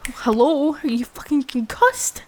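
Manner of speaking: loud, angry disbelief; frustrated, sarcastic shout; exasperated yell. Acted. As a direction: snap at them to snap out of it. Frustrated at unresponsive partner.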